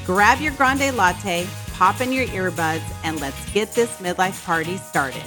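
upbeat host intro read; podcast intro. Acted. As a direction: read it like a show opener. Podcast intro read over music.

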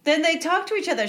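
ordinary talk, nothing marked

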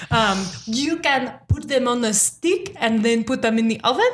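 slipping, hammed-up French accent; a sliding French accent; bad French accent. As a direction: do a French cook badly. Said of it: "then I got German".

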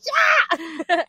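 high, loud cheer; hype yell. Retold or imitated. Raver cheering back, high loud yell. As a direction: cheer the drop. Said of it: "you're like".

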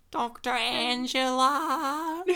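put-on old woman calling for Doctor Angelo. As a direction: call out slowly like a frail old lady. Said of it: "She's like".

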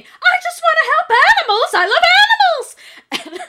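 high, very loud mock scream; imitated screaming. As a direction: scream it like you're losing it over animals. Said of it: "she starts screaming".

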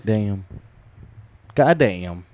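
usual speaking voice

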